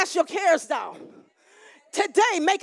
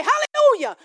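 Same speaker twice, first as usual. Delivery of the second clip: loud hallelujah; 10 semitones higher, 5 dB louder than usual. Retold or imitated. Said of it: "It is so loud in heaven".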